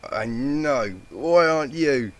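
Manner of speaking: high, loud giggly tipsy reply; giggling. A narrator's reading. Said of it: "she giggled back".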